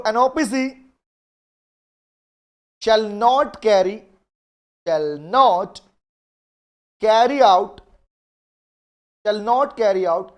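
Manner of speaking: each phrase spaced out very slowly, word by word; slow dictation. Acted. Teacher slowly dictating a law clause to the class. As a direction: dictate clearly so students can write it down. Dictating a legal provision to students.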